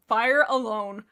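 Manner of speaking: "fire alone" said loud and deliberately; emphatic correction. Real, not acted. Reader fixing her own slip, loud emphasis on "fire alone". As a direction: hit "fire alone" hard to get it right.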